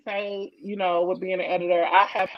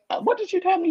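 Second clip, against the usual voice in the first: mock British accent; 11 semitones higher, faster than usual. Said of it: "It's the accent".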